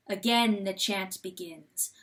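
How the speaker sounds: usual speaking voice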